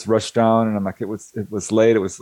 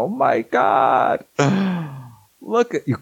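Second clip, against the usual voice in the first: slow, higher, with a gasp; awestruck; 5 semitones higher, slower than usual. Retold or imitated. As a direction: marvel at it breathlessly. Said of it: "we're both screaming and just go".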